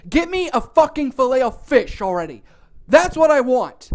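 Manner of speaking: loud, fed-up acted demand; about to snap. Hungry customer snapping at cashier, loud angry order. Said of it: "I'm just about to snap at this point".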